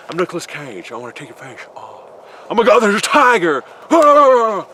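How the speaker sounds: mock terror as Nicolas Cage; Nicolas Cage impression. Nicolas Cage suddenly seeing a tiger, a hammed-up high scream. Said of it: "that's the best I can do".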